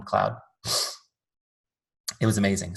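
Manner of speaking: ordinary talk, nothing marked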